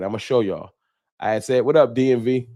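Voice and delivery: usual speaking voice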